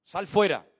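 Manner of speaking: a loud, ringing command to the dead; commanding shout. Acted. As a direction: shout him out of the tomb. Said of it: "la Biblia dice que el Señor gritó".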